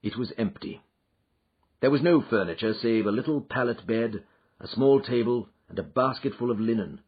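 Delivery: plain reading voice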